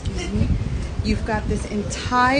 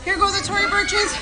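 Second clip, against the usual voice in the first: higher excited cry; 9 semitones higher than usual. Real, not acted. On the slide she repeats it higher and giddier as her shoes fly off.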